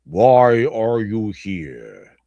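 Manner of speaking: slow, drawn-out devil's question; Satan voice. Retold or imitated. Satan quizzing a new arrival, slow and lightly menacing. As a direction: stretch every word like the devil himself. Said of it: "Satan says".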